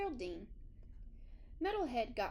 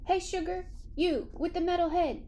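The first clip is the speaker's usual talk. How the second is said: put-on higher, louder friendly call of an old dog; old dog Geraldine calling out; 6 semitones higher, 6 dB louder than usual. A narrator's reading. Geraldine calls to Metalhead in a raised, higher friendly voice. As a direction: call over sweetly to the new dog. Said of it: "she called to him".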